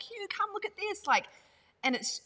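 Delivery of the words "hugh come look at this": put-on high, eager child's call; excited Indian kids. Little kids calling Hugh over to their broken playground, high and eager. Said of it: "they were like".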